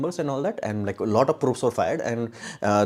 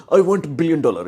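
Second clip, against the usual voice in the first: mocking quote; 6 semitones higher, 9 dB louder than usual. Mocking a greedy wish.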